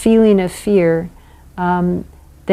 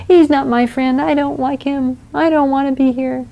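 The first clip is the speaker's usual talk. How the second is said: put-on upset child; 7 semitones higher than usual. Shown as a demonstration. Child voiced protesting.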